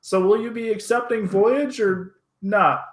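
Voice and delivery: high, loud little man voice; tiny man's high voice; miniature man. Acted. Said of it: "the miniature man says".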